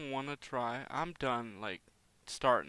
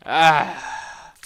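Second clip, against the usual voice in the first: sudden loud startled yelp; startled scream; 2 semitones higher, 15 dB louder than usual. Real, not acted. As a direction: jump and cry out in fright. Sudden jump scare in game.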